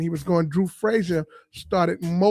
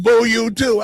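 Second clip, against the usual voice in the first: heckler shouting back; 5 semitones higher, 6 dB louder, slower than usual. Retold or imitated. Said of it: "He's like".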